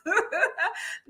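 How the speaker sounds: high, quick burst of giggles; amused laugh. Real, not acted. Coach after her cats and dogs joke, amused high laugh. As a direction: crack up at your own joke.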